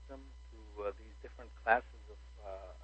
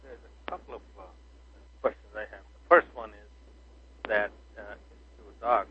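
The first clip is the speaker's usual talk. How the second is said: phone held away; 13 dB louder than usual. Real, not acted. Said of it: "Please speak into the phone".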